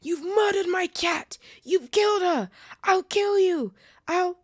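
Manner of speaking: very high, very loud, slow murderous screeching; screeching threats. Acted. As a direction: scream threats at the boy. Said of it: "he screeched".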